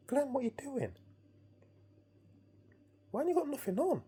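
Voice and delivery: high-pitched incredulous questioning; shocked mates. Retold or imitated. Mates pointing at the half-naked dreamer, shocked. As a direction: imitate aghast friends, voice pitched right up.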